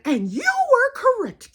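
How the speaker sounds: loud, very high formal concession; Aunt Ada. Retold or imitated. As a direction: imitate a grand aunt pronouncing her verdict. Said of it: "That's just how she talks".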